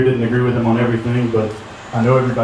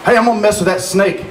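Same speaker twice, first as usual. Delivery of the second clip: put-on high-pitched Lower Alabama accent; hammed-up south Alabama accent, pitched way up; 9 semitones higher than usual. Delivering a joke's punchline in a high Lower Alabama accent. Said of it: "that's a lower Alabama accent".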